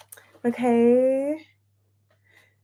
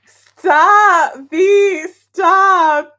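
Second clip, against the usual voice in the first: loud, higher, emphatic "stop"; accidental signature "stop" voice; 6 semitones higher, 10 dB louder, much slower than usual. Real, not acted. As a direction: belt out a high, loud plea to stop. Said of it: "say it like that".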